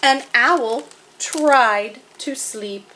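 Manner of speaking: deliberate picture-book narration with vivid animal noises; story reading to children. A narrator's reading. Narrator tells children of sleepless Owl, deliberate expressive storytelling. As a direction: read to little ones, spacing out the refrain.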